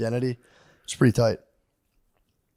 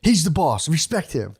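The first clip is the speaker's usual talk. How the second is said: louder, higher mock insistence; insistent mock student; 5 semitones higher, 3 dB louder, slower than usual. Mimicking students who defer to the professor, louder and insistent. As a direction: hammer home that he's the boss. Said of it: "repeats the quote".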